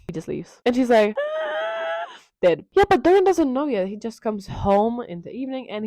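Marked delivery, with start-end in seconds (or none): 1.2-2.1 long, very high gasping squeal; shrieky inhaled squeak, played for laughs; mock dying squeal. Sybil's reaction as he leaves, mock dying squeal.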